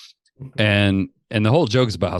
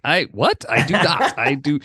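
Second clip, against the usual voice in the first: higher-pitched, baffled retort; incredulous protest; 6 semitones higher, slower than usual. Retold or imitated. Comic retelling his reply to hate mail, incredulous. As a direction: protest the accusation in disbelief. Retells reacting to anti-Semite accusation.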